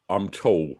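ordinary delivery

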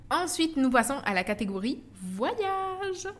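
mock announcer, ending on a high, drawn-out "voyage"; playful announcer. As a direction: announce the new category with a long, high-held last word. Playful announcement of next category. (annonce théâtrale pour rire, « voyage » aigu et étiré)

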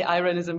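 Irenesen said eye-REN-uh-sen; anglicized pronunciation. Retold or imitated. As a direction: start Irenesen like the word "eye". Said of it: "an anglicized version of it".

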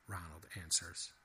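ordinary delivery, nothing marked

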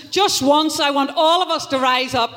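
ordinary talk, nothing marked

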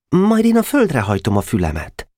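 put-on high, keen boyish voice; small boy showing off his idea; a clever-sounding young Nemecsek; eager boy. A narrator's reading. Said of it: "okos akart lenni".

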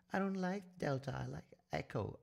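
high-pitched, precious mock operative; badly enacted operative. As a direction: object fussily in a put-on high voice. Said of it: "this is, of course, a very, very bad enactment".

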